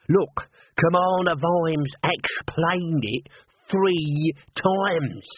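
slow, high, insistent troll; exasperated troll. A narrator's reading. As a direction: spell it out slowly as a troll. Troll exasperated with his partner.

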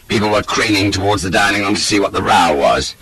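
usual speaking voice

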